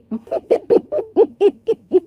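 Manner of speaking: sly villain's laugh after plotting revenge; a scheming serpent woman's cackle; evil cackle. Acted. Serpent bride plots revenge.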